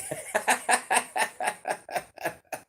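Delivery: long breathy laugh; real laughter. Real, not acted. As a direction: laugh in quick airy bursts, trailing away. Cracks up at own monster talk.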